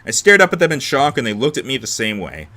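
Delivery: ordinary delivery, nothing marked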